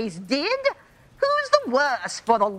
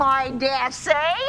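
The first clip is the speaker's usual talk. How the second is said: normal talking voice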